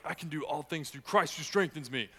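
mock macho "I can do all things"; pumped-up boast. Shown as a demonstration. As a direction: brag it like a bodybuilder showing off. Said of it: "Instead of, like".

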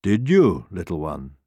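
gentle lion. A narrator's reading. Lion to the timid little hare, gentle.

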